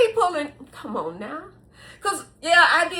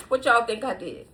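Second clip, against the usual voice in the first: ordinary talk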